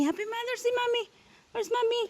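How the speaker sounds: very high little singsong voice. Real, not acted. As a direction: chirp it in a tiny, high voice. Cooing greeting to small child.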